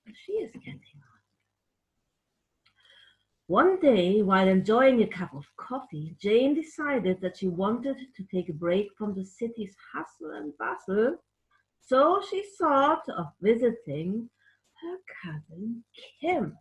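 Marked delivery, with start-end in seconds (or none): 0.1-1.2 hushed curious whisper. Acted. Whispering to the children while peeking at the story. 14.8-16.6 whisper. Acted.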